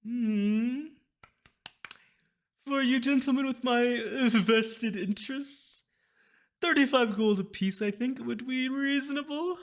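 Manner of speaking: high, loud, slow scheming merchant; smooth greedy price pitch; sly mysterious shopkeeper. Acted. Shopkeeper voice held.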